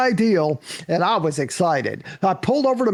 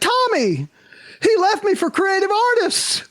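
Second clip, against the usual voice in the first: very high, hammed-up wail; mock crying wail; 12 semitones higher than usual. Agent tells actor who left her, mock crying wail. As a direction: imitate a distraught agent wailing. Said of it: "She's crying like crazy".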